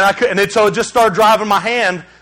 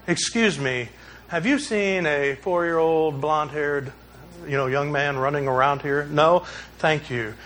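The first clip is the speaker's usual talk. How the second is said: softer, politely composed mock-asking; mock dignified; 6 semitones lower, 8 dB quieter than usual. Pastor mocking how calmly he did not search for his son, dignified. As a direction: play the calm polite father ironically. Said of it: "really dignified?".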